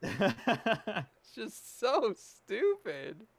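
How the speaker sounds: very slow, laughing through the words. Real, not acted. Friend laughing at the silly movie line. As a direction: giggle it out helplessly.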